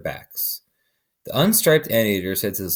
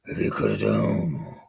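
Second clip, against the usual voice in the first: much lower, slow growling voice; 6 semitones lower, 5 dB quieter, slower than usual. A narrator's reading. Jaguar growling in the sleeping man's ear. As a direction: growl it low like a big cat. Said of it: "He growled in his ear".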